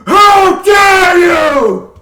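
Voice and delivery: high, drawn-out full-force roar; furious scream. Acted. Barbarian confronting his mentor's false image, screaming in fury. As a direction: scream it in outrage, stretching each word. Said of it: "begins screaming".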